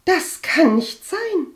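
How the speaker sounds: high, loud, slow disbelief; disbelieving contradiction. A narrator's reading. Cousin refuses to believe maid is sick, disbelieving. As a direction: contradict her, drawing the words out high. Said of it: "widersprach sie".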